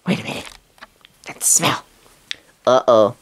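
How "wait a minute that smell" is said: hushed. Pretend. Sniffing out a suspicious smell.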